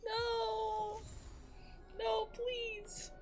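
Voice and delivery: normal talking voice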